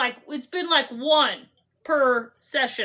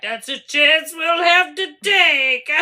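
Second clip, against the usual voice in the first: slow, louder hammed-up heroic line; imitated hero; 6 dB louder, slower than usual. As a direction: draw it out as a mock-dramatic declaration. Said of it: "And he's like".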